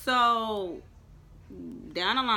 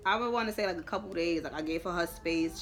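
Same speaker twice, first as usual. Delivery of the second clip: usual speaking voice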